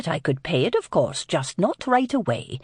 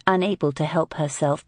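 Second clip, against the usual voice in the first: ordinary delivery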